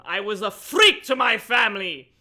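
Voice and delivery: loud, hammy anguished cry; overdramatic outburst. Acted. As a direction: belt it out melodramatically. Overdramatic reading of book.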